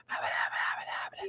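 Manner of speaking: unvoiced whispered nonsense syllables; whispered ASMR parody; mock ASMR mouthing. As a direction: imitate close-mic whispering, mockingly. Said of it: "talking like this".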